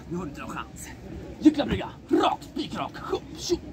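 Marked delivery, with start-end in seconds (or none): 0.1-0.9 muttered taunt. Pretend. Taunt under the breath.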